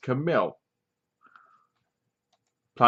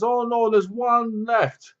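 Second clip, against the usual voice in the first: high, slow dismayed cry; high alarmed; 9 semitones higher, slower than usual. Retold or imitated. Toulon finding one formula cup left, high slow alarm. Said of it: "He goes".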